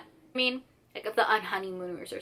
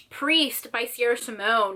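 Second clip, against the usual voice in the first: ordinary delivery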